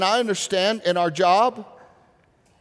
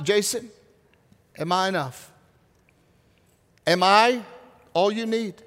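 gentle God voice. Retold or imitated. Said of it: "And he said this".

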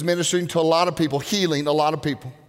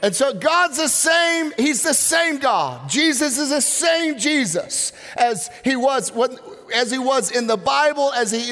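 emphatic preaching; 6 semitones higher than usual. Acted. Preaching point after story.

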